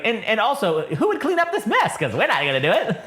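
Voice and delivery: high, fretful droid complaint; fussy protocol droid. Acted. Droid scolding BT-1 about the mess, high and fussy. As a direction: jump high on the question, then drop.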